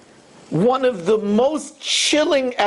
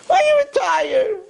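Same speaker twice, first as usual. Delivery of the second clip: mock coaxing; 13 semitones higher, 3 dB louder than usual. Evil inclination telling someone to put learning off, mock coaxing. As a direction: sing out the excuse high.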